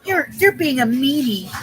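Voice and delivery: put-on high, slow whiny kid voice; a hammed-up sulky kid calling someone a meanie; mock little kid. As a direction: whine it like a sulky child. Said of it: "talking like a little kid".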